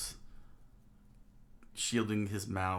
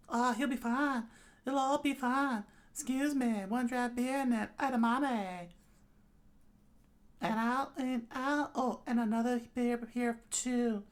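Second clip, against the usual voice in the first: very high character voice; high-pitched, carefree drink order; breezy drinking companion; 3 dB louder than usual. Acted. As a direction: brush it off in a put-on high voice. Plays Himeno ordering drinks.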